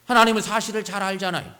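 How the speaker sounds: imagined aggrieved plea in prayer; pleading prayer. Acted. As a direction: appeal insistently to the one who knows. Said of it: "그러면서 하나님께 아뢰는 거죠".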